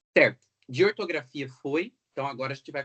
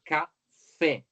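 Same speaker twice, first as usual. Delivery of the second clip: Café with a forceful final FÉ; Café, demonstrating the strong last syllable; stress on last syllable; 2 semitones higher, 4 dB louder than usual. Shown as a demonstration. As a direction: lean hard on the FÉ of Café. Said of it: "O acento recai na última sílaba".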